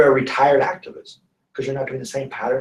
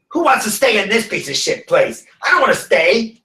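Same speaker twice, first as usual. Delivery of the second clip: mock grumpy old lady; 6 semitones higher, 6 dB louder than usual. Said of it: "She's like".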